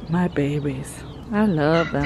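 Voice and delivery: low, soft and tender; fond murmur. Real, not acted. Owner sighing over her goats, low fond murmur. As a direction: say it softly on a contented sigh.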